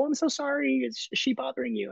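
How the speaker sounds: high, light voice for the dog owner; woman apologizing for her dog, high-pitched; apologetic woman. Retold or imitated. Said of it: "and the woman's go".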